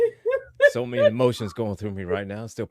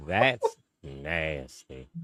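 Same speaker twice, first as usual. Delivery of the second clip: very slow, lower "That's nasty" in a put-on voice; Cleveland Brown impression; 5 semitones lower, much slower than usual. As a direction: drag each word out in a cartoon dad voice. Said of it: "in the words of, of Cleveland Brown".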